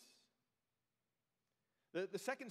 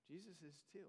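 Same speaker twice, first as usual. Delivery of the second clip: soft echo; 3 semitones lower, 13 dB quieter, faster than usual. Real, not acted.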